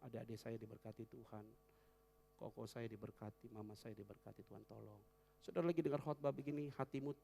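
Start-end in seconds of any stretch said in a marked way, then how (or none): 0.1-5.0 soft, low murmured blessing; quiet inner prayer. Shown as a demonstration. Pastor modelling a silent prayer for family, soft. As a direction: keep it hushed, just between you and God. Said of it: "dalam hati".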